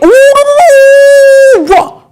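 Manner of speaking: excited squeal. Real, not acted. Trader thrilled by the chart, squealing high and loud. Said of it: "I got a little bit excited".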